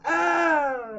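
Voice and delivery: high, loud red-shirt scream; dying crewman cry. Acted. Red-shirt crewman screaming as he falls. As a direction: cry out as you go down.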